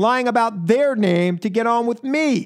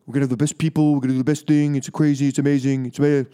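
Trump impression; 4 semitones lower, much faster than usual. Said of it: "I do a".

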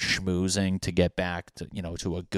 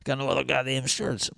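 higher put-on voice, fed up; exasperated defence; 6 semitones higher than usual. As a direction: whine it like nobody believes you. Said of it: "You're like".

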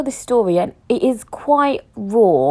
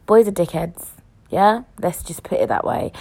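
ordinary delivery

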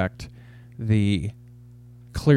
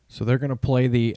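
ordinary delivery

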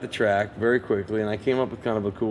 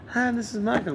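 much higher, light mock impression of his voice; Michael's voice; 14 semitones higher than usual. Michael calling him on the phone, imitated high.

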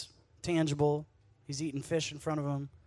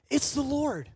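excited; 9 semitones higher, 5 dB louder, slower than usual. Acted. Disciple realizes on the boat.

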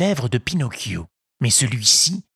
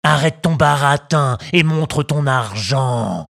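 slow, louder bandit shout; 2 semitones higher, 3 dB louder than usual. Acted. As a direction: bark at the puppet. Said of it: "crièrent en chœur les deux brigands".

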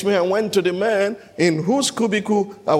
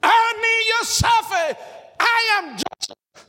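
high, slow, shouted cry of recognition; slower than usual. Acted. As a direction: cry out to his brothers as he breaks down. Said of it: "he cried".

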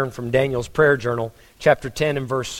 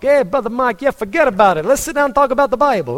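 put-on higher, louder New York Yankee accent; imitating a New York accent, higher and louder; Joe Costa's New York accent; 8 semitones higher, 4 dB louder, faster than usual. New York pastor greeting his friend, imitated New York accent. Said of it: "I could listen to that accent for hours".

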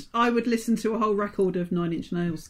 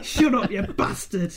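slow Yorkshire bark, half laughing; drawn-out Yorkshire accent, mock gruff; Sean Bean; slower than usual. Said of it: "There's variations as well".

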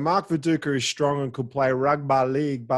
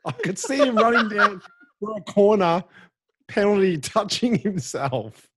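higher, giggly recounting of your own slip; amused retelling; 6 semitones higher than usual. Retold or imitated. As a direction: replay the blunder through laughter, slowing at the end. Retelling his slip amid laughter.

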